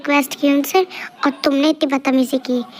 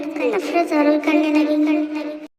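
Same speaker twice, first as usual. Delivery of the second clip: ordinary talk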